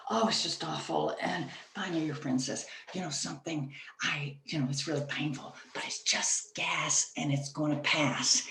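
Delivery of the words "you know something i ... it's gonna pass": hushed reassurance. Acted. Friend at dinner to you, quietly brushing off the pain in a whisper. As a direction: hush it like a secret.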